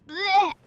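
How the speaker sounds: high, loud gagging noise; disgusted blech. Acted. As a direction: fake a squeamish retch. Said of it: "easily disgusted Cringler".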